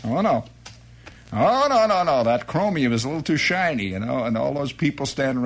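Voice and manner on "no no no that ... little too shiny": ordinary talk, nothing marked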